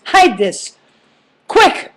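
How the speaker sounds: loud, acted urgent command, jumping high; urgent order. Brother handing over drugs as cops pull up, acted urgency. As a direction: imitate a panicked handoff, punching the last word.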